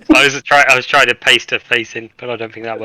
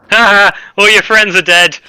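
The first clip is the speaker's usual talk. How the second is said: mocking taunt; 8 semitones higher, 5 dB louder than usual. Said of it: "and I say".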